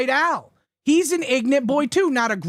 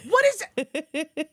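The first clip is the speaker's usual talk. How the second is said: slow, higher put-on voice; mock voice; 5 semitones higher, much slower than usual. Teasing the co-host with a slow put-on voice. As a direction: drag it out in a silly put-on voice. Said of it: "Can you not do that so good?".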